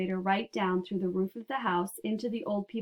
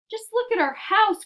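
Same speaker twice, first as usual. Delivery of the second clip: loud, high-pitched dismay; wailing about the ruined house, high and loud; cried out; 13 semitones higher, 7 dB louder than usual. A narrator's reading. Mister Bucket crying out to Wonka over the ruins, loud dismay. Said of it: "cried poor Mister Bucket".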